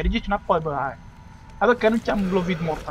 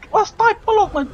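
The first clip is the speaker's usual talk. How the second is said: high, loud call to halt; exasperated yell; 12 semitones higher, 8 dB louder than usual. Real, not acted. Player ordering a moving target to stand still, high exasperated yell. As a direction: yell it high at someone getting away.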